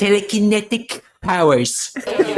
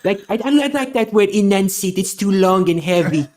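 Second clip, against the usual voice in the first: Trump impression. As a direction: brag like Trump at a rally. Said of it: "And now Trump's gonna climb in Santa's sleigh".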